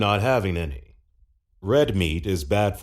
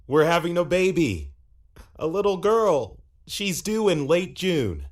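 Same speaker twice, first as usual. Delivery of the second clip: excited; 6 semitones higher than usual. Acted. Announcing a baby.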